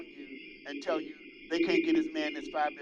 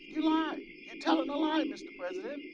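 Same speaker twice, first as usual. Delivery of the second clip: mock heckler; 9 semitones higher than usual. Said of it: "when Joe Wilson said".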